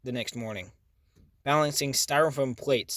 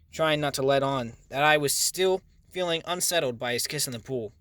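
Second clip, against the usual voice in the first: usual speaking voice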